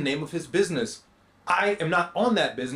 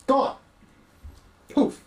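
higher, stunned repeat of Gone; disbelieving echo; 9 semitones higher than usual. Acted. Echoing the word in disbelief.

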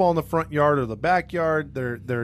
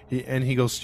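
usual speaking voice

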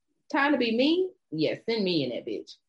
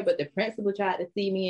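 usual speaking voice

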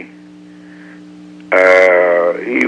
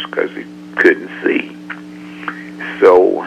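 usual speaking voice

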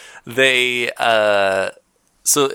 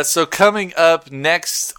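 ordinary talk, nothing marked

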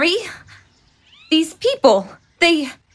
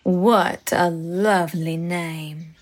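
put-on southern accent; 9 semitones lower, 4 dB quieter than usual. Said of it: "Her southern accent".

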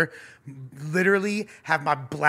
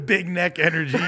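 laughing; 6 semitones higher, 4 dB louder than usual. Real, not acted. Laughing at a neck joke.

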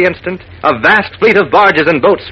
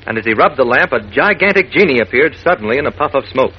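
ordinary delivery

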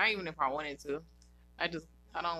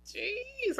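gushing; 10 semitones higher, 5 dB louder than usual. Real, not acted. Gushing over celebrity.

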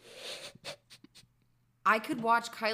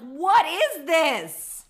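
loud, slow, high exasperated disbelief; mock outrage; 5 semitones higher, 10 dB louder, much slower than usual. Friend mocking gossip to cohost, loud slow disbelief. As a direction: drag out the outrage like it's absurd. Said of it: "I'm like".

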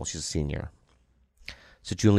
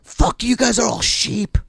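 high, drawn-out mock rant; angry customer; 13 semitones higher than usual. Customer refusing a mask in the store, mock angry. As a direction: imitate an angry man railing at everyone. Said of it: "he was already angry".

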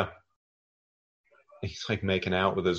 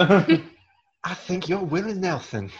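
high, slow put-on drawl voicing the screen; slow, high character voice; 9 semitones higher, much slower than usual.

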